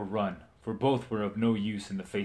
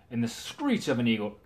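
plain reading voice

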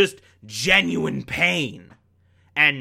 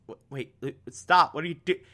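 softer stammering mock panic; panicked Philip; 5 dB quieter, faster than usual. Philip stopping Stan, soft and stammering. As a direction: stumble over the words, alarmed. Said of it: "And Philip's like".

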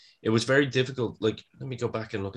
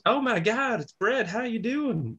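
very high, gushing mock wife; imitating excited wife; 13 semitones higher than usual. Wife recognising a famous caller, high excited mimicry. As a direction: squeal a mock delighted greeting on the phone. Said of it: "the wife answers, she goes".

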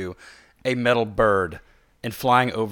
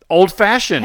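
excited exclaim; 9 semitones higher, 11 dB louder, slower than usual. Real, not acted. Pun on the drink name, exclaimed.

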